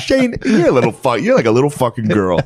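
high, loud taunting voice; imitating dad teasing his son; dad mocking son. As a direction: taunt him like a gruff dad.

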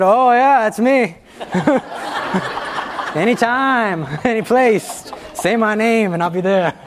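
mock hero boast, giggling; laughing bravado. Teller playing the rescuer to the class, laughing. Jokes as heroic helper, laughing.

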